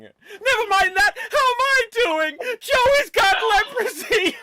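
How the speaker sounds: very high crying shout; Joey with leprosy. Acted. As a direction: shriek it out in despair. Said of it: "Joey Tribbiani suffering from leprosy".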